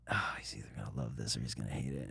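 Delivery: fast, low near-whisper; muttered aside. Retold or imitated. Actor retelling his private worry after the take, hushed aside. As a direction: mutter it quickly under your breath.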